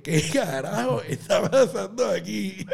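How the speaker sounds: slow, drawn-out, laughing disbelief; baffled crowd look. Retold or imitated. Confused crowd staring at the painted comedian, slow baffled disbelief. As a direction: stretch it out like a stunned crowd staring. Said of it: "todo el mundo me mira como que".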